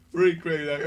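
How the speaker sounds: ordinary talk, nothing marked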